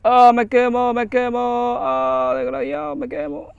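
high, loud panicked cries; panicked yelling. Real, not acted. Gamer yelling that his character is burning. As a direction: yell like you're burning.

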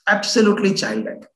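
usual speaking voice

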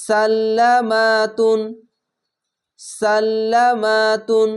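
সাল্লামাতুন read very slowly for clarity; slow teaching read; slow demonstration. Shown as a demonstration. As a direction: draw out সাল্লামাতুন syllable by syllable. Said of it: "এখানে কোনো টান নাই".

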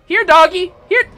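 high, loud pet-calling voice; mock dog call. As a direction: call it over as if it were a dog.

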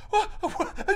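high stunned double take; mock shock. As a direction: gasp it out in disbelief. [name] shocked.

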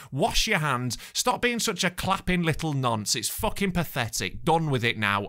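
ordinary delivery

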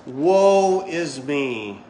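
slow, drawn-out melodramatic whine; theatrical woe, stretched out; mock self-pity. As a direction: ham up the poor-me lament. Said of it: "ready for this?".

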